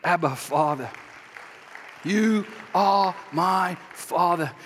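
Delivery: word-by-word, drawn-out address to God; measured, deliberate prayer; slow declaration. Acted. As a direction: say it very slowly, spacing every word. Acted prayer, slowly declared.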